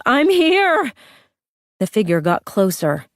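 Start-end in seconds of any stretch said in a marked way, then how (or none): 0.0-0.9 high, loud call; yelling. Acted. Lydia yelling to the figure in the hallway, high yell. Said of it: "she yelled".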